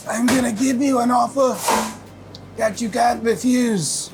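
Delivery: high, strained movie-mobster voice, drawn out at the end; Godfather impersonation. Acted. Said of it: "That's my Godfather impersonation".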